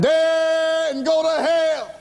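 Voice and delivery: high, long-drawn yell; sustained high-pitched shout; hollered retort. Retold or imitated. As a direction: holler it back, stretching the first word. Screamed retort in retold crash.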